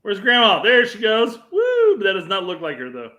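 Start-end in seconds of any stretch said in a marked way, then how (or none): 1.5-2.0 high, loud mock whoop; imitated "woo". Co-host watches grandma go flying, high mock whoop. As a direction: whoop as if flung off a tube. Mimics grandma going airborne.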